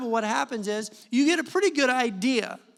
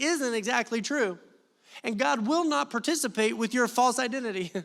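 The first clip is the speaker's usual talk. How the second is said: raised, driving sermon voice; preaching; 4 semitones higher than usual. Acted. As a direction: push the point home to the congregation. Preaching at full force.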